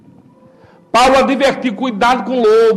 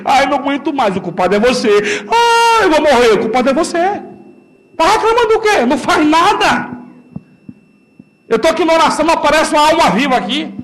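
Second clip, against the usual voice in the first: usual speaking voice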